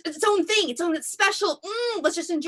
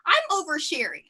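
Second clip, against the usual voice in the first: ordinary delivery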